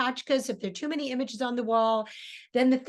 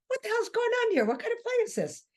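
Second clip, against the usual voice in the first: much higher, puzzled inner voice; baffled audience thought; 12 semitones higher, faster than usual. Retold or imitated. Spectator wondering about the odd play, high and bewildered. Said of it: "And you think".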